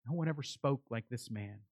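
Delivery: low, soft, hushed awe; awed amazement. Retold or imitated. Officers repeating their answer about Jesus, in hushed amazement. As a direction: drop right down in quiet wonder. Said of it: "There is an awe and an amazement in their response".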